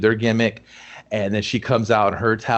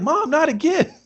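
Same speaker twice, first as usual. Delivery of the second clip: high embarrassed whine; whiny son. Retold or imitated. The son catching his mom fighting, high whine. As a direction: whine like a mortified kid.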